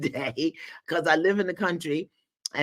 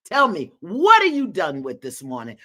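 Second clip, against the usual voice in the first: ordinary delivery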